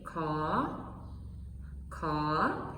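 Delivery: "Ka" said low, rising. Shown as a demonstration. Lao teacher showing group two: rising "Ka". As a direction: let "Ka" climb from low. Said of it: "It's a rising tone".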